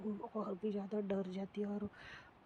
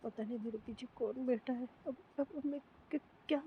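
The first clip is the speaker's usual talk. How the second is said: frightened, quick and high, stumbling over words; a terrified girl stammering a prayer in the dark; scared whisper-prayer; 6 semitones higher than usual. Acted. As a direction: panicky stutter, pitch climbing. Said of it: "तो मन में सोचती है".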